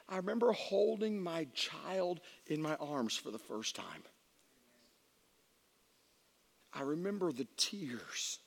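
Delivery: tender reminiscence. Real, not acted. Father recalling holding his newborn, tender and quiet. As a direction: let it settle into quiet awe. Tender memory after the comedy.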